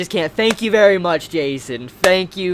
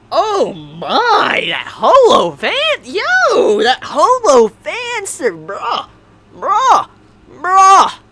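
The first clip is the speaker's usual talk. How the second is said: loud swooping high exclamations; excited fan freak-out; 9 semitones higher, 5 dB louder, much slower than usual. Real, not acted. Holo fan reacting to the book, excited freak-out. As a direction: whoop it out, voice leaping up and down.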